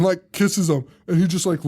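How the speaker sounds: ordinary talk, nothing marked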